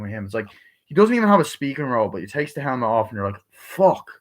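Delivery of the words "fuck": higher-pitched stunned exclamation; mock astonishment. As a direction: blurt it like an amazed viewer. Reaction to surprise cameo.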